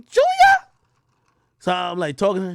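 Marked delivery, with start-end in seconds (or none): 0.1-0.7 high loud mom call. Retold or imitated. Mom calls her son by name, high loud call. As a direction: call him like a mother. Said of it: "She's like".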